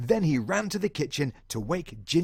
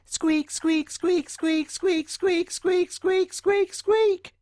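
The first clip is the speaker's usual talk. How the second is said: squeaks rising higher and louder; building mouse squeaks; 5 dB louder, much slower than usual. Acted. John Mouse squeaking ever harder at the sleeping man. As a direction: squeak more and more urgently.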